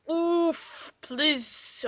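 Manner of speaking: ordinary talk, nothing marked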